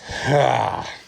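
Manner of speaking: long falling exhale of relief; tired sigh. Real, not acted. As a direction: let out a heavy winded sigh. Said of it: "I'm out of breath".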